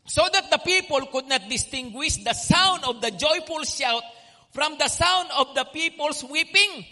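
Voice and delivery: loud, carrying reading of the verse; loud scripture reading. Real, not acted. Preacher reading the verse aloud to the church, loud and carrying. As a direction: read it out boldly to the congregation. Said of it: "sabay-sabay po tayo ulit".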